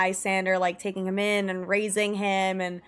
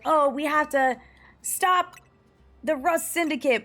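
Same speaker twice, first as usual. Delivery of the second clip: higher-pitched mock alarm; mock urgency; 6 semitones higher than usual. Player mimicking everyone's reaction to the villain, mock urgent. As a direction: dramatically declare a mission, tongue in cheek.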